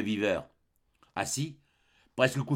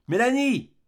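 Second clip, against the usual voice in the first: high, loud call through the house; cry out; 11 semitones higher, 5 dB louder than usual. Acted. Young lady calling the maid from her room, high loud cry. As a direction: shout the maid's name from another room. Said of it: "une voix de femme cria".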